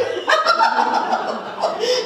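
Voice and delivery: a high bubbling giggle at her own joke. Real, not acted. Laughing at her own joke about the lady, high giggle. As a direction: let a squeaky laugh burst out.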